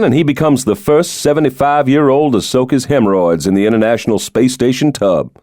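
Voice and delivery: teasing about his rich father, pitch lifted; lightly voiced president. Stoddard jokes about his father.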